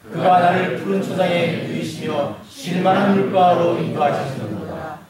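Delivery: slow, measured scripture recitation; reading aloud together. Real, not acted. Pastor leads everyone in reading the psalm, slow unison recitation. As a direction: lead a congregation in reading aloud, drawing out each phrase. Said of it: "다 같이 큰 소리로 한번 같이 읽어볼까요".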